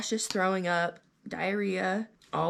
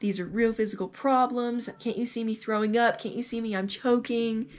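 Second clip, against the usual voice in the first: indignant protest, higher and pushed; retold anger; 4 semitones higher, 4 dB louder than usual. Patient protesting the anxiety diagnosis to doctor, retold anger. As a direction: re-enact being mad, pitch raised, hitting each accusation. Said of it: "I was so mad".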